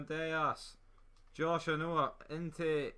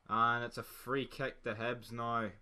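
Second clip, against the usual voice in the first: ordinary delivery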